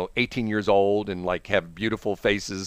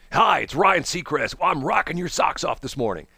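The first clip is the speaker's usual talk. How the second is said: rock radio DJ; 5 semitones higher than usual. Acted. Ryan Seacrest opening a rock station's morning show, hyped DJ voice. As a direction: hype it up like a rock station host. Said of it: "He's gonna be on the rock station".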